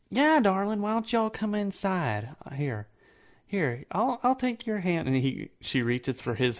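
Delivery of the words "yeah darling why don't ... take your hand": higher-pitched, coaxing woman's voice; welcoming woman. Acted. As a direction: invite them in with a high sweet lilt.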